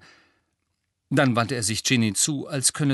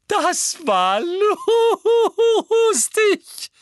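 much higher, loud, shrieking with laughter; laughing; 7 dB louder, much slower than usual. Acted. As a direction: squeal it through helpless laughter. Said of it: "Luna lachte so heftig".

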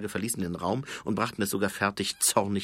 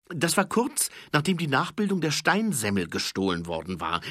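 put-on high, fast, keen young voice; eager high-voiced corporal reporting; 8 semitones higher, 5 dB louder than usual. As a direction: rattle off the report, high and quick. Corporal explains timing.

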